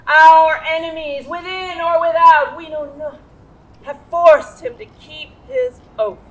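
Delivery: mother acting out the rally speech; rousing oratory. Shown as a demonstration. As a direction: whip the crowd to a sworn oath.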